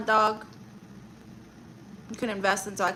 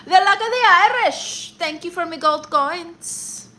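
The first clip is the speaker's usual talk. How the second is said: high, loud hammed-up Irish leprechaun voice; mock Irish accent; 8 semitones higher, 8 dB louder than usual. Streamer thanking a viewer for gold coins, in a hammed-up Irish accent. Gift alert read in Irish voice.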